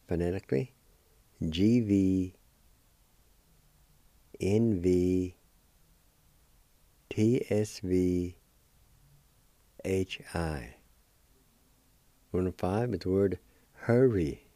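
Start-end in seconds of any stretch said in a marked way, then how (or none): 1.5-10.8 letters spelled out slowly; slow, careful letter spelling. Shown as a demonstration. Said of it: "It's spelled like this".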